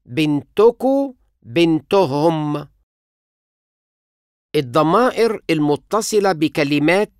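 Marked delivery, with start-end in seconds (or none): 0.1-1.1 بنتكو said slowly as bin-tu-ku, u before ku; slow pronunciation demo. Shown as a demonstration. Teacher slowly models the ku ending for learners. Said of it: "ضمة زائد كو". 1.4-2.7 بنتهم said slowly as bin-to-hum, u before hum; slow pronunciation demo. Shown as a demonstration. Teacher slowly models the hum ending for learners. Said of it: "أو هم".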